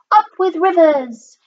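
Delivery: high, gleeful hoot falling at the end. A narrator's reading. Duckbill herd delighted by the river, hooting. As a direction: hoot it like a happy cheer. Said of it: "And they hooted".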